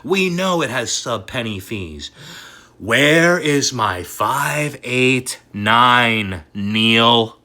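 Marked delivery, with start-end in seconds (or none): 2.8-7.4 very slow, stretched demand; drawn-out plea. Real, not acted. Asking Neil where his target is, drawn-out plea. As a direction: drag every word out in despair.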